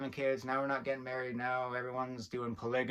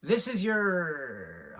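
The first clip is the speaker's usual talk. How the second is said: worked up; much slower than usual. Acted. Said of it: "I'm getting a little worked up".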